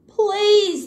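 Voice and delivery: high pleading please; mock begging bird. A narrator's reading. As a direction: beg him, voice up high. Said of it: "begged the bird".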